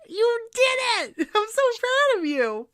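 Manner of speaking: high-pitched, drawn-out squeals of congratulation; elated cheering. Real, not acted. Cohost celebrates reader finally saying the word, elated cheering. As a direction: cheer like a proud coach, pitch way up.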